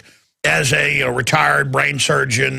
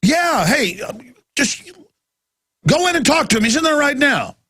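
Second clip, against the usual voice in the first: breezy burglar; 10 semitones higher than usual. Retold or imitated. Burglar fobbing off the homeowner.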